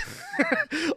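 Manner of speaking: real high giggling burst; high-pitched laugh. Real, not acted. Streamer telling friends of his punch, high laugh. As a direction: crack up, voice shooting up high.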